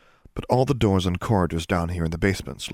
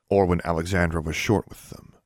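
ordinary delivery

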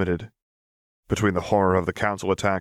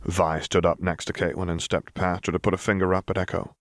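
ordinary delivery, nothing marked

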